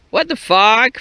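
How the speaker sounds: loud, high what the fuck; exasperated shout. Real, not acted. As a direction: burst out in frustration. Frustrated at water hole.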